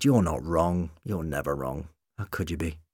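low, soft reassurance; tender farewell. A narrator's reading. Doctor's tender farewell to Rose.

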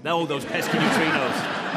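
put-on slow, drawn-out New York accent. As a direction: say it like a New York movie character. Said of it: "In a New York accent".

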